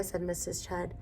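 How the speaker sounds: ordinary delivery, nothing marked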